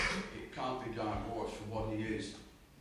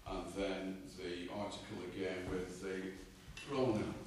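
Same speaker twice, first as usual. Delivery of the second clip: normal talking voice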